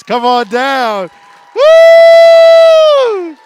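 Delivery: slow, loud call then a high whoop; host hyping the winner; game-show shout. Real, not acted. Announcing grand prize winner.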